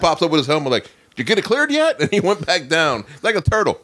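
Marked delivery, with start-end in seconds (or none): 0.8-2.0 high, small mock-nervous voice; timid buddy. Guest imitating his buddy popping up from a foxhole, high timid voice. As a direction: peep it out from a hiding hole. Acted Lugo peeking out of hole.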